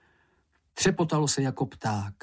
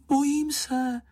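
very high little frightened cry; frightened call; 14 semitones higher than usual. A narrator's reading. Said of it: "volala slečna Ferka". (vyděšené vysoké volání)

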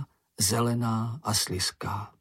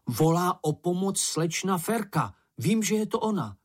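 higher, insistent retort; snapping back; 6 semitones higher than usual. A narrator's reading. Mumínek insists Ferka needs help, agitated retort. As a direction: press the point, rising in pitch. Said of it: "obořil se na něj Mumínek".

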